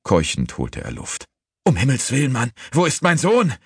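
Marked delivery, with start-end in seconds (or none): 1.6-3.5 high, panicked shout; horrified cry. A narrator's reading. Father demanding his son from servant, panicked high shout. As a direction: cry out as horror takes hold. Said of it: "rief Aisleby, den mit einem Mal das Entsetzen packte".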